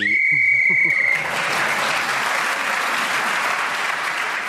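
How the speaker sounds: breathless drawn-out laughter; long laugh. Real, not acted. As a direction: collapse into laughter. Enema story cracks him up.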